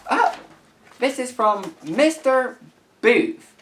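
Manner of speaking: ordinary talk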